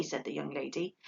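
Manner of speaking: ordinary delivery, nothing marked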